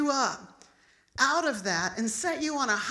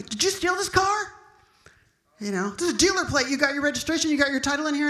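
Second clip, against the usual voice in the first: high, raised mock interrogation; imitated policeman's loud accusation; accusing cop; 4 semitones higher, 4 dB louder than usual. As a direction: fire questions like an irate officer. Accusing cop interrogates her, acted.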